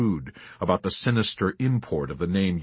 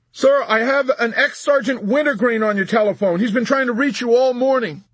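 high, loud, urgent report; raised voice of a harried subordinate; urgent corporal; 14 semitones higher, 6 dB louder than usual. A narrator's reading. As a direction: call it out to the general. Corporal reporting amid uproar.

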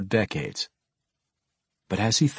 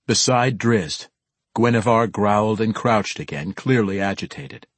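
plain reading voice

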